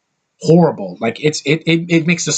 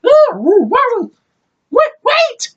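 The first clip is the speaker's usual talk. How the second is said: squeaky, very high cartoon alien yelps; mock the goofy CGI alien in a high squeal; Jar Jar voice; 4 dB louder, slower than usual. Reviewer mocking Jar Jar's panicked cries, very high squeaky voice. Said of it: "Jar Jar C G I face going".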